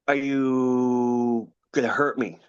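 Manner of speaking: slow, timid frightened question; a scared kid facing the alien, asking slowly; scared child. Retold or imitated. Boy facing the alien in his room, slow and frightened.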